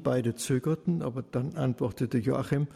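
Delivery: ordinary delivery, nothing marked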